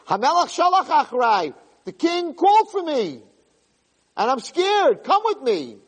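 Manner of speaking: high, pleading cry for help; frightened plea to a friend; pleading, scared. Acted. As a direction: beg your friend to come, voice up high.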